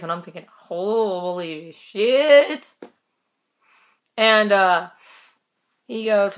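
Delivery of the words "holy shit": very slow, stretched-out retold reaction; slow-motion inner "oh no"; drawn-out dismay. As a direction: drag each word right out. Retold dismayed thought at hearing advice.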